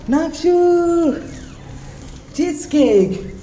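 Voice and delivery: high, slow sing-song calling of the son's name; teasing call. Acted. Father teasing his son about the cheesecake, high and drawn out. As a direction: stretch it out like teasing a kid.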